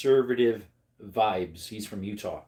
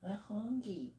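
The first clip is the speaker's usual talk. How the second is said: high, soft voice speaking for the dog; a lightly voiced pleading puppy; dog's inner voice; 9 semitones higher, 10 dB quieter than usual. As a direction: give the hungry dog a high little voice. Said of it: "He's like".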